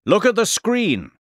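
high-pitched urging call; shouting. A narrator's reading. Professor directs the startled boy to the screen, shouting. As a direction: call attention to something exciting. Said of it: "shouted the professor".

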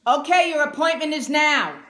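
loud, sharp call across the waiting room. Retold or imitated. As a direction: announce it loudly, like a fed-up daughter. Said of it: "she raised her voice and she said it loud".

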